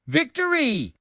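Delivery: high triumphant cry of victory; triumphant shout. A narrator's reading. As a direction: shout it like winning gold. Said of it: "and shouted".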